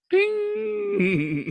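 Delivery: a sharp, very high "ding" like a bell; ping it out high as a cartoon cue; high ding sound effect. Preacher marking the woman's sudden shock, high ding. Imitates sudden change of face.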